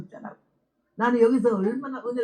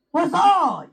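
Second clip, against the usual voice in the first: loud, high call across the house; loud call with the pitch thrown up high; yelling for husband; 6 semitones higher, 6 dB louder, much slower than usual. Retold or imitated. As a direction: holler for him to come out. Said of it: "근데 왜 이렇게 소리 질러?".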